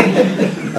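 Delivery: soft airy chuckle; a short breathy laugh; amused soft chuckle. Real, not acted. Speaker laughs at reply.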